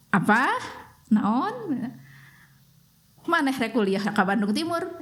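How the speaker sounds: a mother mishearing, startled and incredulous; mother's startled question. Retold or imitated. Mother mishears daughter's ranking news, startled and incredulous.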